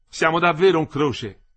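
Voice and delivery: high, loud shout. A narrator's reading. Olindo shouts up to Ersilia at the window, shouting. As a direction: cry it out to someone above. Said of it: "egli gridò".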